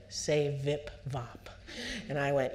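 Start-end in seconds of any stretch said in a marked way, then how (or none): 0.1-1.5 slow, deliberate "Say vip vop"; slow librarian. Retold or imitated. Dutch librarian teaching the speaker a new phrase, slow and deliberate. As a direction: spell it out slowly like a patient librarian. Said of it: "The librarian leaned forward and said".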